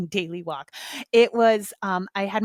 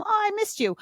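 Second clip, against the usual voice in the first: high, sweet missed-you; tender high-pitched neighbour; 11 semitones higher, faster than usual. Retold or imitated.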